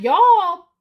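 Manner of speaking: loud high call to the crowd. Retold or imitated. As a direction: yell it at them. Calling out fake Christians.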